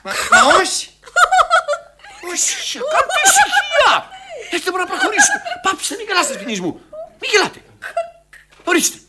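high-pitched, loud protests; exasperated calls for silence. Acted. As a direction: shout down the laughter, voice leaping up. Director quells laughter at rehearsal.

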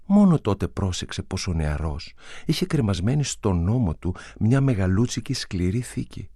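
ordinary delivery, nothing marked

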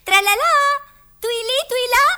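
loud, high sing-song call; Alice shouting the twins' names. Acted. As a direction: hail the twins from across the clearing.

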